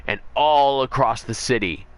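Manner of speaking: usual speaking voice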